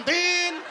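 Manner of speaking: short honk, din; horn noise. Shown as a demonstration. Comic mimics the scooter horn turning left. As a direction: squeak it out like a horn. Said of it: "suara klakson".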